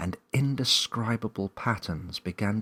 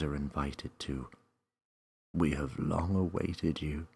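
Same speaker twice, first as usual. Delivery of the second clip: strong but calming voice welcoming Millie; calming mysterious voice; 3 semitones lower than usual. Acted. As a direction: reassure a scared child from everywhere. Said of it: "a strong but calming voice".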